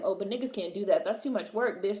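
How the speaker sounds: ordinary talk, nothing marked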